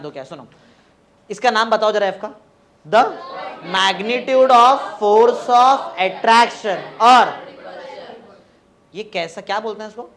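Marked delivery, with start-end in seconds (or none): 2.9-7.3 loud, very slow, stretched-out chant; drawn-out syllables, left hanging for students to finish; class recitation. Real, not acted. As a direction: lead the class word by word. Leading class in repeating definition.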